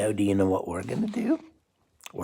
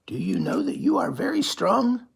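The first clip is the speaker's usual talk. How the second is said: much higher, awed puppet voice; Grover voice amazed; 12 semitones higher than usual. Acted. Grover marvelling at the child, very high. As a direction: go way up, astonished muppet. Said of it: "And Grover says".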